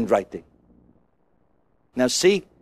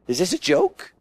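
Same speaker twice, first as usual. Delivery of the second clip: scoffing disbelief; 2 semitones lower, 3 dB quieter than usual. Retold or imitated. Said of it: "look at that and say".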